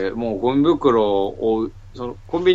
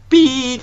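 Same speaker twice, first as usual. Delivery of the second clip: high, loud electronic beep; high-pitched beeping noise; imitated machine beep; 11 semitones higher, 7 dB louder, slower than usual.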